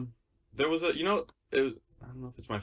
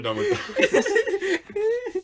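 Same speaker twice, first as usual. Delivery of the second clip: breaking into high giggles; can't get the words out for laughing; 7 dB louder, much slower than usual. Real, not acted. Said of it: "I can't, I don't know".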